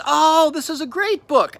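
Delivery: high, drawn-out gush of admiration; admiring clerk. Retold or imitated. Bookstore clerk looking down at his book, admiring. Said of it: "looks down at the book and says".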